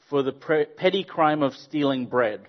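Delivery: ordinary talk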